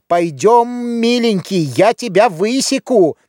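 high, stretched-out mock-sweet threat; mother's threatening cry. A narrator's reading. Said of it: "кричала она". (высокий угрожающий крик матери)

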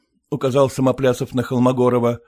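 usual speaking voice

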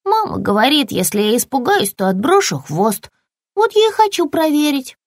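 put-on high-pitched eager young lizard; little lizard, eager. Little lizard explains her plan to the puppy, high and eager.

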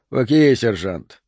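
usual speaking voice

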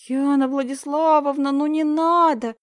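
high, slow whining plea; little girls begging their teacher. Acted. Girls whining to teacher not to be expelled, high pleading. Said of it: "заныли подружки".

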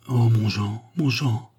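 longing sigh, the second "mon Jean" rising. A narrator's reading. Madame Eldrin sighing to her lover, longing sigh. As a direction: sigh his name as she falls against him. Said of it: "soupira-t-elle en s'abattant sur lui".

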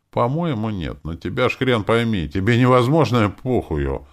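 ordinary delivery

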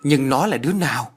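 a touch of exasperated urgency; impatient. A narrator's reading. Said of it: "Kim Thoa quá sốt ruột".

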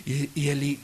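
usual speaking voice

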